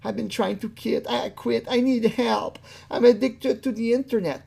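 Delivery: high, whiny plea for help; mock pleading. Voicing the interviewee's supposed plea for help, mock pleading. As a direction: mimic him begging in a high put-on voice. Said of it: "He's really trying to say".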